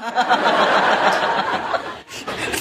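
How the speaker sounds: hearty burst of laughter. Real, not acted. Master teasing her frightened disciples, real laugh.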